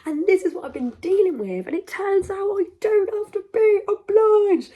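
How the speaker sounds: high, loud and triumphant; rising joyful proclamation; excited declaration. Real, not acted. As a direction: announce your freedom with a lift. Declaring liberation, raised voice.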